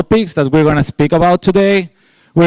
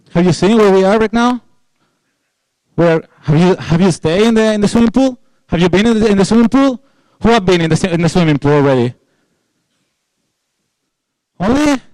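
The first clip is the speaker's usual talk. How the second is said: teasing the audience; 4 semitones higher, faster than usual. Real, not acted.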